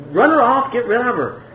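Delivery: high-pitched, urgent dismissal, retold; retold annoyed demand. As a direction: act out fed-up disciples. Disciples demand woman be sent away.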